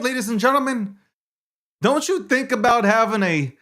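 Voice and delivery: hyped, high commercial voice; ad read. Acted. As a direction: sell it like a radio ad. Reads a host ad.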